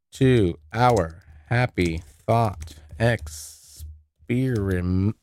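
very slow, word by word; slow, halting syllables of someone typing; typing aloud. Real, not acted. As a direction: stretch each word out as you laboriously type it. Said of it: "I'm an idiot when it comes to typing words".

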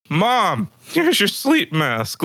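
high, drawn-out pretend courtesy; playful call to Mom; mock-helpful offer.

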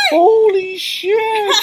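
long, high, loud astonishment; amazed reaction. Retold or imitated. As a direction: stretch it out in mock awe. Amazed reaction on looking over.

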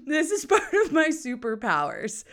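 high cackle running into the words; laughing. Real, not acted. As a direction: boast through your own laughter. Laughs at own boast.

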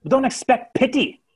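high, loud sneering retort. Acted. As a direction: spit it back with contempt. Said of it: "with a sneer".

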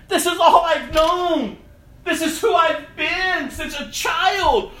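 anguished shouting, pitched high and loud; a desperate prayer hurled at the sky; crying out to God. Retold or imitated. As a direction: plead and rail at God at full voice. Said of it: "I had to get real and raw with God".

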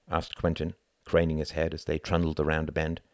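plain reading voice

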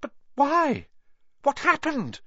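put-on high, pressing boy's questions; urgent. A narrator's reading. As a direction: demand an answer urgently. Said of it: "Harry asked urgently".

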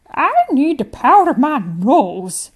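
put-on high, loud, slow genteel old woman; a prim grandmother excusing herself; elderly lady announcing grandly.

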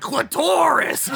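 high, slow, screeching shout; Gilbert Gottfried shout. Retold or imitated. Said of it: "him going".

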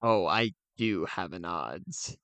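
ordinary talk

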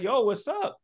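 high bright greeting voice; cheerful mom. Retold or imitated. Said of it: "she was like".

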